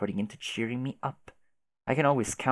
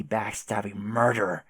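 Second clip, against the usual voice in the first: ordinary talk, nothing marked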